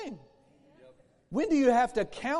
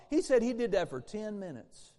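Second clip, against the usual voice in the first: ordinary talk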